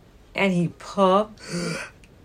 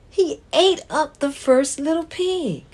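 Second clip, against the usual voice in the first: dramatic; 8 semitones higher than usual. Acted. Wolf eats pig, dramatic.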